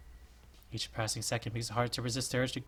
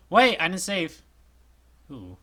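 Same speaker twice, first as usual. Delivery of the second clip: ordinary delivery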